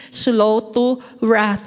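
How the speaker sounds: drawn-out, slow words. Shown as a demonstration. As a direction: stretch each word to show slowness. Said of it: "slow to speak". (dahan-dahang bigkas)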